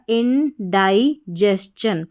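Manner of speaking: "इंडाइजेशन" ending in चन after an स, not शन; correct pronunciation. Shown as a demonstration. Teacher showing how indigestion is read, correct pronunciation. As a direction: say "इंडाइजेशन" as in-dai-jes-chun. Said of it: "इसको पढ़ा जाएगा".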